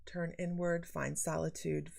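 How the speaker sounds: normal talking voice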